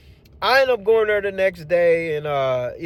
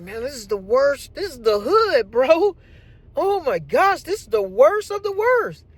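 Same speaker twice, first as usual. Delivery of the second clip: climbing higher and higher in disbelief; rising dismay; 8 semitones higher than usual. Retold or imitated. Driver seeing the bad neighborhood, rising dismay. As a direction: voice rising with each complaint.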